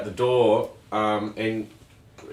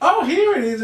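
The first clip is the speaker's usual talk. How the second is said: high, sing-out fake surprise; mock discovery; 4 dB louder than usual. Co-host mimics client suddenly finding the money, mock surprise. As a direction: imitate a client pretending to stumble on it. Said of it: "yeah, she's like".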